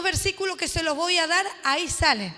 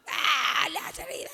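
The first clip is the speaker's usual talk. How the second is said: demon shriek; 3 dB quieter, much slower than usual. Retold or imitated. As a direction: recoil from the word like a demon. Demon reacts to the blood.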